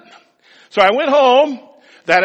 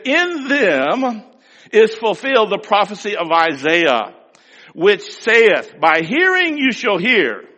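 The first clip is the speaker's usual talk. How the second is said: slow, louder solemn reading; scripture reading; 4 semitones higher, 6 dB louder, slower than usual. Acted. Preacher quoting Jesus to congregation, slow and solemn. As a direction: intone the prophecy gravely.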